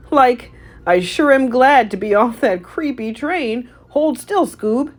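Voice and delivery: relieved and goofy; Shaggy voice. A narrator's reading. Shaggy to Scooby at the Louvre, high goofy relief. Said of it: "said Shaggy".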